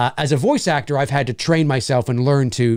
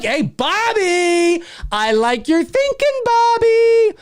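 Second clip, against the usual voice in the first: high, drawn-out holler to a fan; big playful high shout-out; calling out; slower than usual. Real, not acted. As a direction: sing out the name, stretched and very high. Calls out to a commenter.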